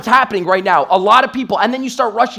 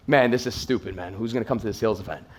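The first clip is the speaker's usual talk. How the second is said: low, quiet, deflated doubt; subdued, skeptical grumble; dismissive friends; 8 semitones lower, 8 dB quieter than usual. Retold or imitated. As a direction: imitate unimpressed friends, soft and low. Doubting friends in retold dialogue.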